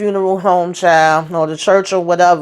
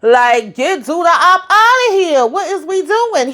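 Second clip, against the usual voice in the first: high, fed-up rant breaking into laughs; ranting at the mourners with a laugh; exasperated rant; 5 semitones higher than usual. Real, not acted. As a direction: vent your frustration, half laughing.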